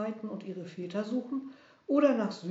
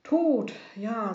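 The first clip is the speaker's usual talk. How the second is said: bitter exclamation; 3 semitones higher, 8 dB louder than usual. Acted. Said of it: "sagte das Mädchen".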